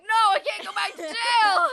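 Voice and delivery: high, very loud, drawn-out wail; hammed-up panicked shouting; desperate arrested man. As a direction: shout it like a man dragged off in handcuffs. Imitates man being arrested.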